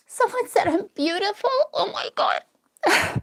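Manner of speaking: high, choked tearful voice; overcome, words squeezed out through real tears; crying. Real, not acted. Streamer told by a viewer she's beautiful, breaking into tears. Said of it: "because of the crying".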